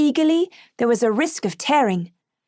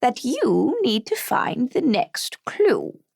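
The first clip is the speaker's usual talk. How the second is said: slow, hushed, almost kindly voice; slower than usual. Acted. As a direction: grandmother gently telling the child the rule. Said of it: "in an almost kindly voice".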